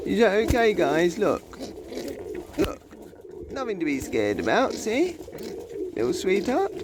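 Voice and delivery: high, doting reassurance; cooing to little birds; soothing pet talk. Real, not acted. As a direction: reassure a nervous pet, voice pitched right up. Soothing the scared pigeons.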